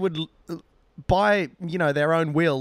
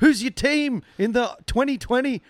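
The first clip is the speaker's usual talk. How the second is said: put-on eager drunk chatter; 8 semitones higher than usual. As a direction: gush about cricket tipsily. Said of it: "I'm like".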